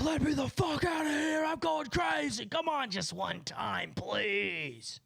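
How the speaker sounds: high frantic begging, easing into a slow drawn-out plea; desperate caged gargoyle. Acted. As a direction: play a gargoyle going crazy behind bars. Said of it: "we have the Gargoyle in the cage".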